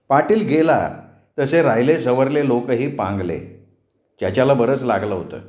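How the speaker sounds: ordinary delivery, nothing marked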